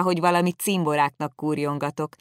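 ordinary delivery

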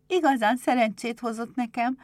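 high-pitched cheerful thanks; happy little girl. Acted. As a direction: say it as a grateful girl.

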